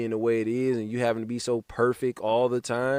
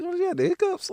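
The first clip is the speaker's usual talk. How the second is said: mocking high voice. Mocking friend's hiccup line.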